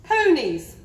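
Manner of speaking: high, loud princess bellow. A narrator's reading. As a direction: bellow it like a spoilt child. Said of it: "bellowed the little princess".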